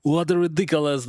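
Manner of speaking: whiny high complaining gamer; a hammed-up annoyed squadmate; mocking complaint. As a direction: mimic a teammate griping about a looter. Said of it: "one guy was complaining about me".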